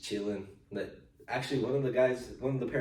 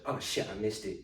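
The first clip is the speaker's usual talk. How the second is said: offhand, softer imitation of a nurse; casual; 5 dB quieter than usual. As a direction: toss it off as a minor slip. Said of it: "just casually".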